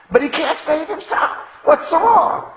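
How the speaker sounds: loud, high mocking jeer; scoffing. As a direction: jeer up at him, laughing at his weakness. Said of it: "they scoffed".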